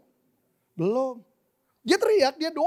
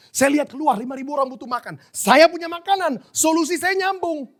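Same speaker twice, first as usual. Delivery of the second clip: put-on higher, louder child's boast; small child talking; 7 semitones higher, 6 dB louder than usual. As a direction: speak up as a confident little kid. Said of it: "cara anak kecil bilang begini". (gaya anak kecil bicara lantang)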